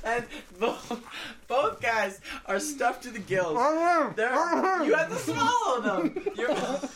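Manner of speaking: giggling live commentary, pitch high; laughing. Real, not acted. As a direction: commentate while cracking up. Host commentates, laughing throughout.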